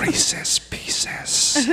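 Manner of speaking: high unvoiced laughing whisper; sweet nothings whisper. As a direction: giggle while whispering. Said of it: "Whisper sweet nothings in my ears".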